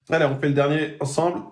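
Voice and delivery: ordinary talk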